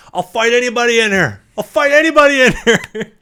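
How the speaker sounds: hammed-up high, loud challenge; mock fighter yelling out a challenge to all comers; Jake Paul. Boxer walking into an old folks home, mock shouted challenge. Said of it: "Jake Paul walking to an old folks home being like".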